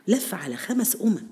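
ordinary delivery

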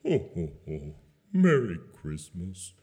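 a depressed Santa going through the motions; sad Santa. Acted. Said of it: "maybe add a bit of sadness".

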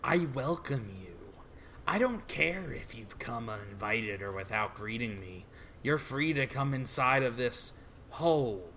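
low, very soft menace; soft-spoken villain. Acted. Villain lets intruder inside the hole, low and soft.

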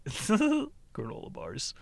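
laughing through the words. Real, not acted. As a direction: chuckle it out. Laughing at the granola list.

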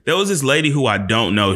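ordinary talk, nothing marked

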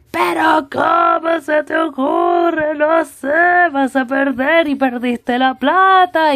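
high, slow, shouted scolding; mock outrage like every father; scolding dad. Father scolding his daughter over the phone, a slow, high, shouted scolding. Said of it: "como todos los padres".

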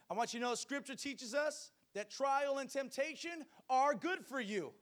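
loud, high-pitched fiery preaching; booming motivational speech. Acted. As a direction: preach it out to the whole church. Preaching at full force.

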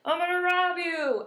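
playful, drawn-out robber's announcement; mock robber. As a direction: imitate a hold-up with little menace. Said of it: "this guy went into a Subway".

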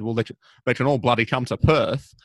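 imitating an Australian pundit, lightly; a fair shot at a broad Australian accent; quoting the Australian guy in an Australian accent. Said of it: "that was definitely Australian".

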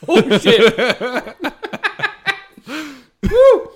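loud laughing whoop; burst of laughter. Real, not acted. Hot sauce hits, bursts out laughing.

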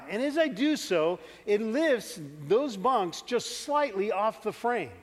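ordinary delivery

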